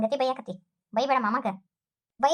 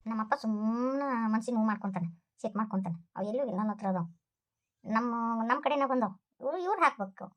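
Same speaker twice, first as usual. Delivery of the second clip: lower, much softer secret aside; hushed aside; 6 semitones lower, 6 dB quieter than usual. Acted. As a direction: confess it under your breath. Secret aside, gold is with her. (ಪಿಸುಮಾತಿನ ಗುಟ್ಟು)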